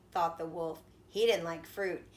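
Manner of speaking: usual speaking voice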